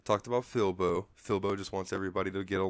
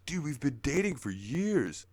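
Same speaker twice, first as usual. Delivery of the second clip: higher, incredulous bro voice; exasperated Chandlo; 7 semitones higher than usual. Retold or imitated. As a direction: play the bro stating the obvious. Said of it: "Chandler's like".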